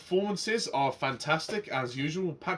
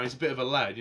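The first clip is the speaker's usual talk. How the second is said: usual speaking voice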